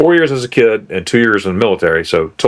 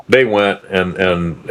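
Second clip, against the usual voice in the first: ordinary talk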